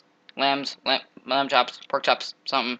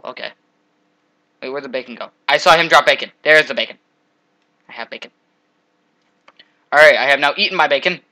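ordinary talk, nothing marked

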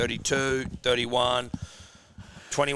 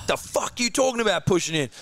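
retold angry challenge; 8 semitones higher than usual. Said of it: "And he goes".

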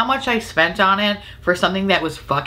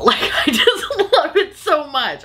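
laughing gush; 10 semitones higher, slower than usual. Real, not acted.

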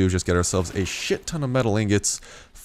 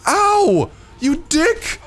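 high-pitched yelps and furious shouting; outraged screaming; 5 dB louder, slower than usual. Real, not acted. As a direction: scream at the creature attacking you. Attacked in game, yelling at attacker.

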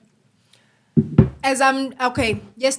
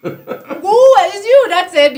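high, loud, drawn-out objection; laughing protest; 9 semitones higher, 11 dB louder, much slower than usual. Real, not acted. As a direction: throw the blame back while laughing. Laughing protest at partner's accusation.

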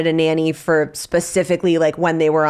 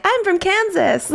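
much higher, laughing small-town innocence; naive mom. Retold or imitated. Mimicking mom's answer to doubters, high and laughing. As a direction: play the wide-eyed girl from Kansas, half laughing. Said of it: "And mom's like".

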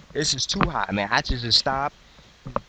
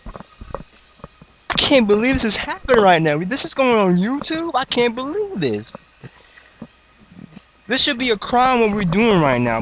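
high-pitched, excited squeal of disbelief; overjoyed, pitched way up; hyped disbelief; 8 semitones higher, 3 dB louder than usual. Acted. Hyped reaction to the cypher.

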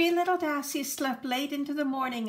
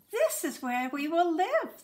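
a high, eager announcement; excited; 6 semitones higher than usual. Acted. Sisters agreeing on their new home, lightly excited. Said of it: "they agreed excitedly".

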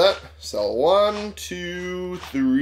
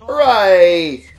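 cheer; 6 semitones higher, 9 dB louder than usual. Real, not acted. Cheering after a good trade.

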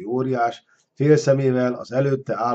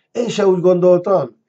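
higher, louder startled cry; alarmed calling after; 7 semitones higher, 6 dB louder than usual. A narrator's reading. Man calls after the retreating dragon, alarmed. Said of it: "kiáltott utána ilyetten Ármány".

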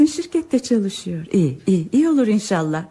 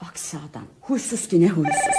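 much softer, lower grumble under her breath; grumbled aside; 4 semitones lower, 5 dB quieter than usual. Acted. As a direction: complain about him to herself. Wife grumbles aside about husband.

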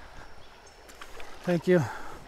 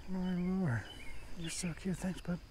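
whisper; 2 semitones higher, 11 dB quieter, slower than usual. Real, not acted. Whispering to fish on a vlog.

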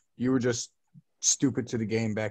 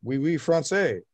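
retold mock French; 6 semitones higher, 5 dB louder than usual. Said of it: "I'm like".

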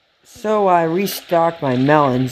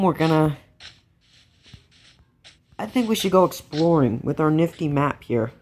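usual speaking voice

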